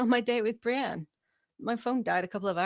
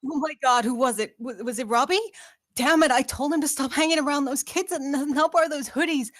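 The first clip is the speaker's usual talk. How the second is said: higher, louder, frantic questions; panicked; 6 semitones higher, 6 dB louder than usual. Acted. Charlie panics on hearing of a shooting, frantic and loud. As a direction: beg for news in rising panic.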